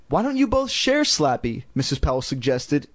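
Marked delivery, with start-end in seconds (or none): 0.1-1.6 high, chirpy clueless mother; silly mom voice. Acted.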